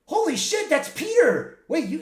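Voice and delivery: high, loud gasp of recognition; stunned exclamation. Retold or imitated. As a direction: blurt out amazed recognition. The Deadpools recognize Peter.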